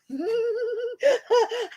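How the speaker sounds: high gleeful giggle; delighted giggle. Real, not acted. Delighted laugh after imitation.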